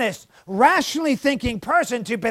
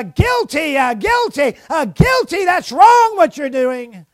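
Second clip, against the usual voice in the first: loud, high cry of guilty; conscience shouting guilty; 5 semitones higher, 8 dB louder than usual. Retold or imitated. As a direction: call out the verdict like an alarm. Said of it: "It says".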